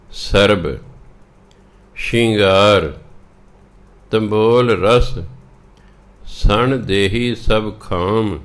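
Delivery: slow, measured Gurbani reading; scripture recitation. Acted. Teacher reading the shabad to learners, slow recitation. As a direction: recite each line slowly, drawing out the words.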